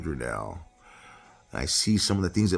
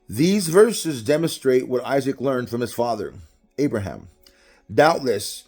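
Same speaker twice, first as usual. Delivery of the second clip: loud, higher-pitched sermon delivery; preaching; 6 semitones higher, 6 dB louder than usual. Real, not acted. Preacher teaching congregation about Isaac's fear, emphatic preaching. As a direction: preach it out, driving home key words.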